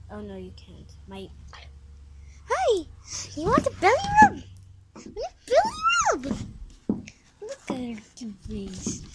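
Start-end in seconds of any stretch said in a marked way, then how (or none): 2.5-6.5 fast, squealing pet voice; pet talk. Real, not acted. Baby-talking dog, very high. 7.4-9.0 whisper. Real, not acted. Whispered aside about dog.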